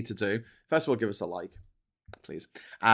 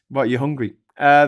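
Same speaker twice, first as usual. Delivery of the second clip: usual speaking voice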